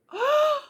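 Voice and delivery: sharp high gasp of disbelief; shocked gasp. Retold or imitated. Viewer gasping at a calmly told horror story, sharp high gasp. Shocked listener reacting to calm.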